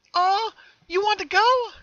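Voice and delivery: very high and loud, perky. Acted. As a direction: chirp it with cheery cruelty. Happy twin questions leaving.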